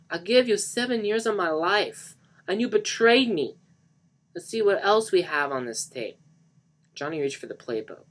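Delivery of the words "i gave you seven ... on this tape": loud, high-pitched angry yelling; furious scream. Acted. Johnny accuses Lisa of betrayal, yelling furiously. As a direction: rage at the one who betrayed you. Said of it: "he screamed".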